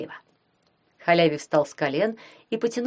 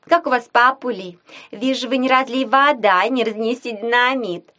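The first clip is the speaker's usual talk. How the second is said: lively teasing; 5 semitones higher, 5 dB louder than usual. Acted. Daughter teasing parents.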